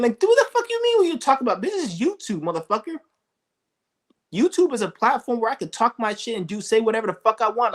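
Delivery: outraged shouting at haters; furious rant. Real, not acted. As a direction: tear into the critics at full heat.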